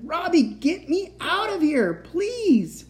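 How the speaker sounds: very high, desperate plea; screaming. A narrator's reading. Captive child begs the boy to free her, screaming. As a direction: scream for rescue, pitch at the top. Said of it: "I screamed".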